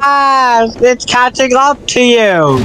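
being chased. Real, not acted.

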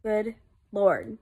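normal talking voice

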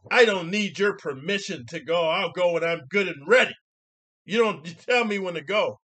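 loud, high retold scolding; imitating her yell. Lady in car telling off a man who waved her through, mock yelling. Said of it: "this is what she yells to me".

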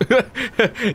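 short high chuckle; laugh. Real, not acted. Streamer who ran ads by accident, short laugh. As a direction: chuckle at your own mishap.

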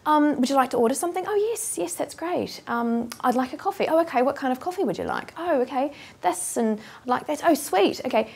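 a breezy, friendly Kiwi cafe exchange; polite New Zealand chat. Retold or imitated. Actress imitating a polite New Zealand barista and customer. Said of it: "You go in and you say".

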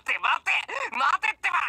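high, loud laughing call; gleeful shout. Acted. Boris calls after fleeing Pierce, laughing gleefully. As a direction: shout wait while laughing.

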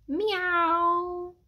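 high, long drawn-out meow; cat's meow. A narrator's reading. Cat looms over the hiding hamster, meowing. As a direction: imitate a cat with one lingering high note. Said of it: "Then I heard it".

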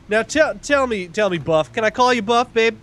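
high, oily come-on; butter him up; sleazy charmer. Acted. Player character buttering up the banker, high and oily.

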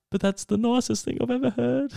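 high-pitched mimicked gushing; giggly, tearful impression. Retelling her happy tears at the news, mock giggly and tearful. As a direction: imitate her overcome and giggly, voice way up. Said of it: "She's giggling and going".

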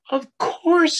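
high, sing-song of course; Large, reassuring. Acted. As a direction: toss it up high like a loving parent. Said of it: "said Large".

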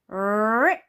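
loud rolled r rip; long rolling rip sound; paper-ripping effect. Acted. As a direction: trill it like tearing paper. Imitates paper tearing.

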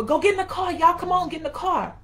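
high, loud mock holler; mama yelling. Mama rounding up everybody to get in the car, a loud mock yell. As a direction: call the kids out across the church lot.

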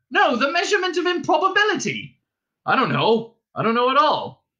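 high, loud exasperated retort; exasperated shouting. A narrator's reading. Ford correcting Arthur about improbability, exasperated shouting. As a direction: raise voice and pitch in frustration.

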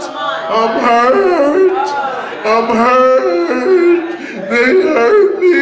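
mocking the crybaby who says they hurt him; mock whining. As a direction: whine it like a hurt child who wants pity.

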